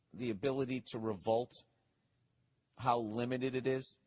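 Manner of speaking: ordinary delivery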